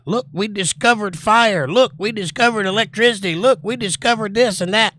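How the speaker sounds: high, mocking boastful voice; mock the self-proud European; smug European. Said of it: "I love the British and the European philosophy".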